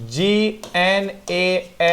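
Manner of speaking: slow spelled letters G N A S H. Shown as a demonstration. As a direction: read each letter slowly and apart. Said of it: "spelling letters of gnash". (धीरे-धीरे अक्षर बोलना)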